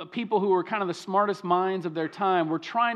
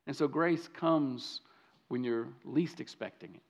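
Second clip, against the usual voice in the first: hushed tender line; 6 semitones lower, 7 dB quieter than usual. Acted. Preacher drops to intimate hush.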